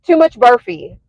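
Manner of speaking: imitating a dad's Indian accent; lightly done Indian accent. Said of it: "like, in his Indian accent".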